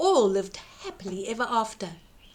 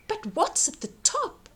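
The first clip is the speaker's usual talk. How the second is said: put-on high, wondering young voice; curious boy; 9 semitones higher than usual. Jack gazing up the beanstalk, high and curious. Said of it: "Jack cried".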